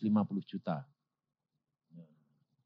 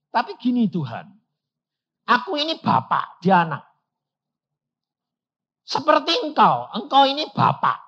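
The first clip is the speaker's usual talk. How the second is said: raised, high pleading to God; loud pleading prayer; 9 semitones higher, 11 dB louder than usual. Retold or imitated. Preacher loudly pleading with God as a father.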